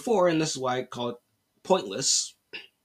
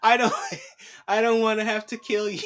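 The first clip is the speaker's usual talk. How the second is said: high, hammed-up pleading killer; mock reluctant villain; 5 semitones higher than usual. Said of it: "that's how these people sound".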